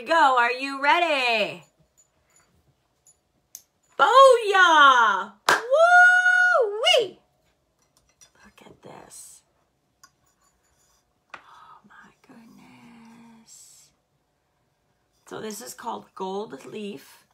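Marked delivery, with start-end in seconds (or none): 4.0-7.2 loud, high celebratory whoops; excited whoop. Real, not acted. Host whooping at the lit warmer, loud and high. 8.4-13.9 slow breathless whisper of wonder; awed whisper. Real, not acted. Host marvelling at the glow, whispering slowly. Awed reveal of glowing warmer.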